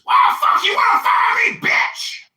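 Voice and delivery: screaming high and loud in outrage; hammed-up screeching rage; mock furious scream. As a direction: blast it like a fired man losing it. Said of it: "And he says".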